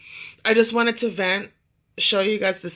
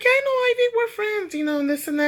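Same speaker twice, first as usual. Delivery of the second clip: high-pitched eager gossip; excited chatter; faster than usual. Retold or imitated. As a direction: chatter it out, thrilled to know her. Friend eagerly claims knowing Ivy.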